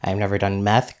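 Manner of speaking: ordinary talk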